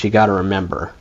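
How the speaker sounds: ordinary delivery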